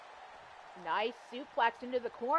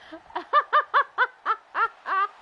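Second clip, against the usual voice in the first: loud laugh; 10 semitones higher, 8 dB louder than usual. Real, not acted. Still laughing at partner.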